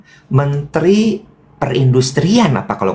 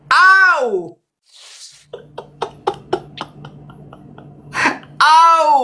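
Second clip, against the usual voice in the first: a high, drawn-out squeal of delight; excited squeal. Real, not acted. Host squealing with delight over the repost. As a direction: squeal like a thrilled fan.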